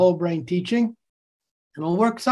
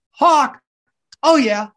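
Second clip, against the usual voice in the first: loud, higher hawk call and cheer; 5 semitones higher, 5 dB louder than usual. Shown as a demonstration. Teacher rewards class with hawk cheer, loud. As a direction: pump up the class. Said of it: "Great job".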